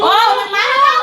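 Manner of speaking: high panicked yell; scared shout. Real, not acted. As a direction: cry out as the ghost appears. Ghost suddenly arrives.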